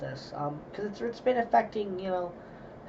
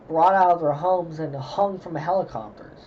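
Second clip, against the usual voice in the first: ordinary delivery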